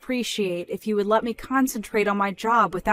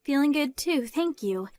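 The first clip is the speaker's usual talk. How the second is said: higher, bright-eyed younger sister; cheerful daughter Samantha; 8 semitones higher than usual. Acted. Samantha telling mom about the baby, happy. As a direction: answer mom sweetly, a little gushing.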